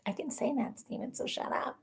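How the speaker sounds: soft mock put-down; quiet teasing. Real, not acted. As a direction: hush it like a secret tease. Quiet teasing aside to Steven.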